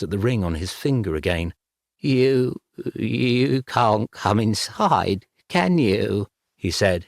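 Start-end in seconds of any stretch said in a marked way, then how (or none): 2.0-6.3 slow, shaky frightened question; trembling voice. Acted. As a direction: tremble with fear. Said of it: "his voice trembling".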